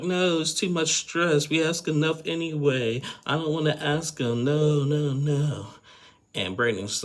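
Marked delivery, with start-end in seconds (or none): 0.0-5.7 high-pitched whiny mock sobbing, a bit slowed; imitating a tearful wife refusing to ask; mock crying plea. Mocking Mary crying to Brandon, whiny high plea. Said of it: "Mary just starts crying".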